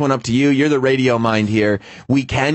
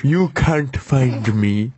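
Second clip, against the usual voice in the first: slow silly voice; much slower than usual. Pretend. Voicing the horse in photos.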